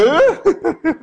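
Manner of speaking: high burst of laughter, unlike his own voice; mocking laugh. Cook hears coffee with toddy, high mocking laugh. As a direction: laugh out at the odd order.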